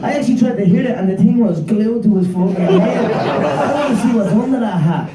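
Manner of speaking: giggling while mocking the hat; laughing. Real, not acted.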